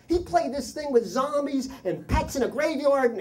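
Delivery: put-on high-pitched horrified kid voice; imitated tattling child. Boy tells parents about the tape, imitated horror.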